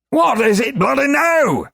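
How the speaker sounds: high, loud little-man yell; furious shout. Acted. Globin yelling at Korash, furious shout. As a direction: roar each bloody at him.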